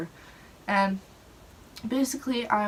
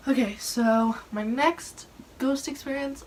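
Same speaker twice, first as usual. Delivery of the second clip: playful spooky voice; 5 semitones higher, 3 dB louder than usual. Acted. Announcing next ghost story.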